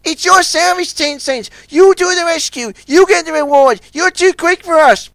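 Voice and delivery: high mock-generous call; a sly shout urging a rival on. A narrator's reading. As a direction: push the job onto him loudly. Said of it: "then shouted".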